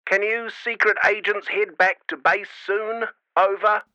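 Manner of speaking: mum through a crackly walkie-talkie; easy denial over radio static; scratchy radio voice. A narrator's reading. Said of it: "the scratchy voice".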